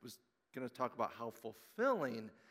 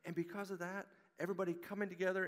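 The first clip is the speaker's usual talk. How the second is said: preaching; 6 semitones higher than usual. Real, not acted. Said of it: "preacher's message build".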